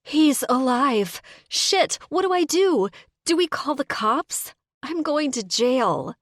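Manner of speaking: high, loud, frantic questions; panicked. Acted. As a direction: spill it all out in terror. Addie panics as Thad moans.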